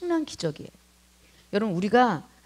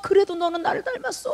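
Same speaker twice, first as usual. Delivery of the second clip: excited retelling; 10 semitones higher than usual. Acted. Lord's insistent praise voiced.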